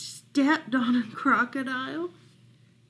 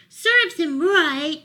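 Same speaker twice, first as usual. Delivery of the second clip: gloating frog; 6 semitones higher, 7 dB louder, slower than usual. Acted. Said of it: "said Frog".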